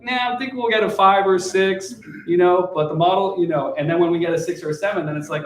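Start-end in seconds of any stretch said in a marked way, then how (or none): 0.0-3.7 higher, breezy downplaying. Lowering an investor's expectations, breezy and higher. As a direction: shrug it off and promise less. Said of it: "now I'm like".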